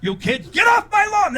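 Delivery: put-on grumpy neighbour shouting at children from the lawn; cranky old man yelling. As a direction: bark it at the kids off the porch. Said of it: "I'm snapping at the neighborhood children".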